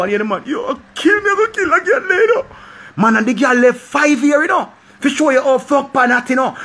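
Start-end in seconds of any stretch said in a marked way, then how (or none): 0.4-2.4 high wailing imitation of a distraught man; mock bawling. Friend phoning about his breakup, mock bawling. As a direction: wail it like a hammed-up breakdown. Acting out the crying friend's call.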